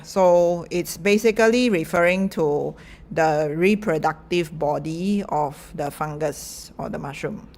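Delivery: ordinary delivery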